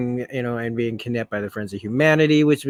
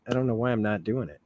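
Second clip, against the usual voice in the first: plain reading voice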